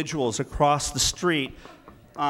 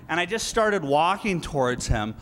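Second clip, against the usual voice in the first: normal talking voice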